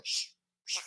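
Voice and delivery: short repeated hushes; shushing. Retold or imitated. Pastor stopping the lady talking, shushing. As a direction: imitate cutting someone off with shushes. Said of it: "telling the lady".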